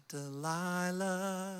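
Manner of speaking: slow, drawn-out lightly crooned name; radio host voice. Retold or imitated. Pastor imitates the Sunday night radio host, slow and smooth.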